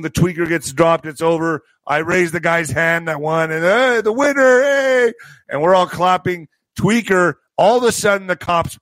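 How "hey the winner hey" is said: high, whooping winner announcement; mock cheering. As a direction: crown the champ with a cheer.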